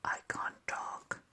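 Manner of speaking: hushed whisper so nothing startles. Real, not acted. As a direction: breathe the words without voice. Hushed so birds stay.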